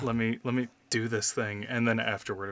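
usual speaking voice

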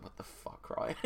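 breathy whisper of disbelief; whispered aside. Retold or imitated. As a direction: whisper it under your breath. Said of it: "my dad's just like".

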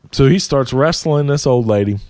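ordinary talk, nothing marked

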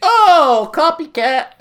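high, loud playful outburst; excited exclamation. Real, not acted. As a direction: tease them loudly. Friend copies dentist visit.

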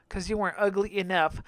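ordinary talk, nothing marked

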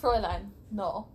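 put-on hammy German accent, stern; mock German doctor refusing; bad German accent. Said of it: "we're gonna have bad German accents".

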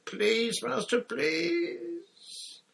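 very high, drawn-out whimpering plea; moaning plea. A narrator's reading. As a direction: beg your master through the pain. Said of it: "moaned Wormtail".

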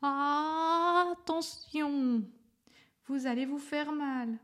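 high, long drawn-out "Attention"; stretched, alarmed high call; stammered warning. A narrator's reading. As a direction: cry out a slow, loud warning. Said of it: "bégaya".

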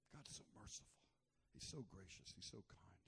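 soft, hushed reverent praise; whispered adoration of God; tender awe. Real, not acted. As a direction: breathe it out in wonder. Tender praise after story.

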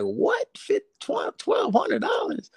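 squeaky falsetto, stretched out in disbelief; shocked disbelief. Retold or imitated. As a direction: balk at the price in a mock-stunned high voice. Retelling his shock at the price.

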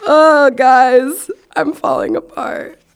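playfully overwhelmed; flustered wail. Real, not acted. Flustered over crush.

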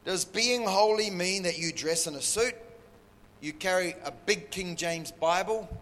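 ordinary talk

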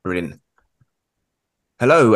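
ordinary talk

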